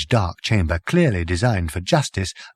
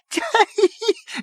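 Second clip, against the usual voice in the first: very high shrieking giggle; hysterical laugh. Acted. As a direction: convulse with laughter. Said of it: "convulsed with fresh paroxysms of laughter".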